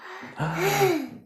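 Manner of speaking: high sharp gasp; cartoon warthog's horrified gasp; gasp of horror. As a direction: suck in air in horror. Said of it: "Pumbaa gasped in horror".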